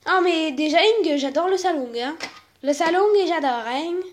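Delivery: put-on thick Marseille accent with "-ing" endings; Marseillais accent. [name] admires the living room, thick Marseille accent. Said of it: "c'est mon accent".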